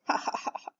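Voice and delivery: soft breathy mock chuckle. Father chuckling at his worried daughter, soft. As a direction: laugh it off gently. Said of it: "Mister Hartwell chuckled".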